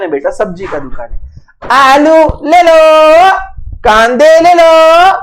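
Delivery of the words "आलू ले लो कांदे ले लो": loud, high, slow street vendor's cry; vegetable hawker call. Pretend. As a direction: call out to passers-by like a market hawker. Teacher plays vegetable seller calling.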